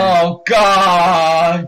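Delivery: loud horrified cry; loud groan. Real, not acted. Trump wins election news.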